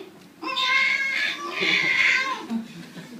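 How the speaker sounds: put-on high, drawn-out baby wail; imitated baby cry. Speaker showing how babies cry, imitated high wail. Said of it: "the baby when they cry".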